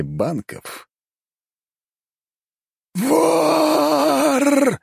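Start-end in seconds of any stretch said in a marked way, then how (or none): 2.9-4.8 booming otherworldly shriek; eldritch horror. Acted. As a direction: howl it from beyond the grave. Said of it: "грохочет потусторонний голос".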